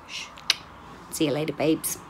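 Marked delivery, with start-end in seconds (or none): 0.1-0.3 whispering. Real, not acted.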